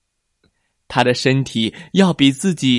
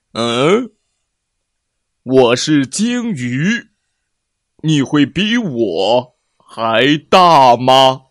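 slow whale voice; 5 dB louder, slower than usual. Acted. Huge whale speaks.

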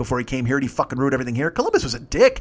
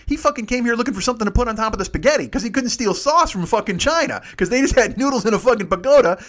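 ordinary delivery

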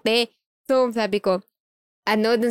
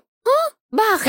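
sharp high confused question; startled huh; 10 semitones higher, much slower than usual. Retold or imitated. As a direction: caught off guard, asking why. Confused by his anger. (gulat na tanong, mataas ang tono)

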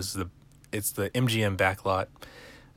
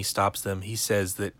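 ordinary delivery

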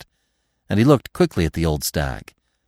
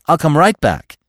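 high, louder, urgent promise; pleading; 7 semitones higher, 5 dB louder than usual. A narrator's reading. Bambi promising the stag he'll return, high and pleading. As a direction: plead to be allowed to go. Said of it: "he pleaded".

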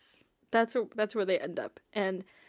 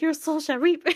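higher, a hammed-up doom voice; mock dramatic line; 7 semitones higher, 4 dB louder than usual. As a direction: ham up a villain's prophecy. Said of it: "what did my brother say? He goes".

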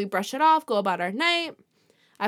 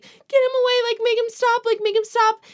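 high-pitched, louder mock panicked plea; retold child's scream, pitched way up; imitating frightened child; 12 semitones higher, 4 dB louder than usual. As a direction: act out a crying child begging for help. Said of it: "She's screaming".

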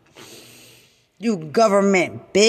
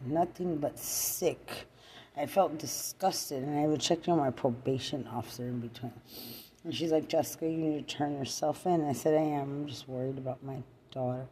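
much softer, tired low voice; barely above a murmur, worn out; speaking quietly to the camera about probation; hushed, drained; 4 semitones lower, 12 dB quieter, faster than usual. Real, not acted. Drops to near-whisper recounting.

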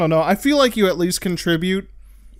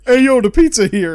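loud, higher-pitched shout; mock calling out; 7 semitones higher, 8 dB louder than usual. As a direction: holler it across the house. Imitating a man calling out.

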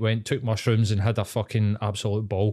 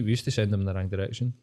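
ordinary talk

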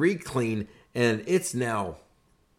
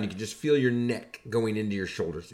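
ordinary talk

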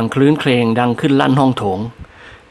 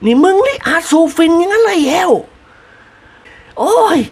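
high, loud scolding shriek; 14 semitones higher than usual. A narrator's reading. Mistress loudly scolds the servant for the opium den. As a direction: rail at the servant in shock. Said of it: "เอ็ดลั่น".

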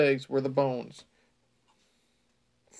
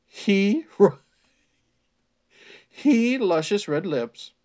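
laughing; 5 semitones higher, 8 dB louder, much slower than usual. Real, not acted. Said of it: "that doesn't sound fucked up".

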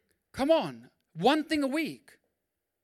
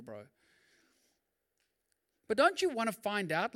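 usual speaking voice

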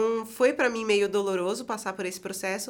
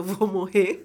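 rindo; 2 semitones lower, slower than usual. Retold or imitated.

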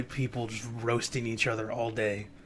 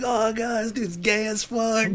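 high, hammed-up jeering heckle; mock heckle; 9 semitones higher, 3 dB louder, slower than usual. MPs trolling across the desk, high mock heckle. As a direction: heckle like a rowdy backbencher.

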